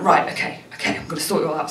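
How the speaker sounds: soft, near-whispered determination; quiet covert determination; hushed resolve. Retold or imitated. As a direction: murmur the plan to yourself. Whispered self-talk after mum's plan.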